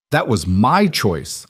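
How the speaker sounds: slow, firm, hitting my; firm insistence. A narrator's reading. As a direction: stress on my, said slowly. Defending a private choice.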